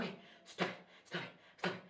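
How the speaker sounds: urgent whisper to herself; hushed, unvoiced-sounding self-scolding; whispered self-talk. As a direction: whisper it, trying to get a grip. Retelling telling herself to stop.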